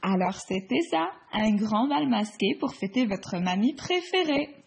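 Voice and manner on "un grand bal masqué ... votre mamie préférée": usual speaking voice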